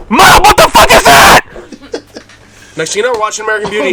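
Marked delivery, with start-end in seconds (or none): 0.1-1.4 much higher, louder laughing shout; laughing outraged yell. Retold or imitated. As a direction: yell at mom in disbelief. Retold outrage at mom.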